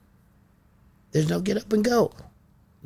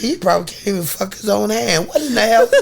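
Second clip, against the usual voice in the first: high, loud laughing jeer; laughing, louder jeer at the twins; laughing disbelief; 11 semitones higher, 7 dB louder than usual. Retold or imitated. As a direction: cackle through the insult.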